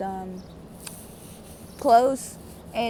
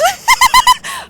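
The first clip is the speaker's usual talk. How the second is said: high, loud burst of laughter; real high-pitched cackle; 11 dB louder than usual. Real, not acted. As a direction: crack up at your own absurdity. Laughs at own aliens remark.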